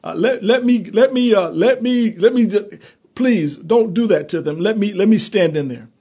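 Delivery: high, loud, stammering plea to God; pleading intercessor. Retold or imitated. Preacher voicing someone begging an angry God, pleading. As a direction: beg God to stop, tripping over the words. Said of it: "And then somebody said".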